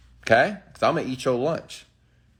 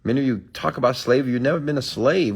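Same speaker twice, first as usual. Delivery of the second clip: ordinary talk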